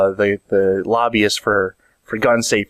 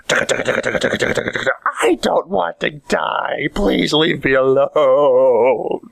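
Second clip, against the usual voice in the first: drawn-out, hammed-up begging; mock terrified pleading; 4 semitones higher, much slower than usual. Cop under gunfire begs the shooter, hammed-up terror. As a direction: beg for your life with stretched-out words.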